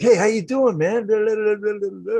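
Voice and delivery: high, loud, chatty caller voice; caller's chatter. Retold or imitated. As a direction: mimic an eager caller rattling on. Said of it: "they'd already be into their".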